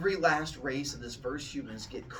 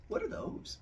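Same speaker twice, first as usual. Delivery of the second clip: high, breathless whisper of shock; horrified whisper; 7 dB quieter than usual. Acted. Zeus whispering in horror at the elder gods. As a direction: gasp it out, scared stiff.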